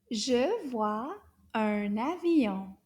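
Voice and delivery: Je vois un avion said slowly and evenly; slow model. Shown as a demonstration. Teacher to class, slow model sentence. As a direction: spell out each word of Je vois un avion. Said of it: "One more time".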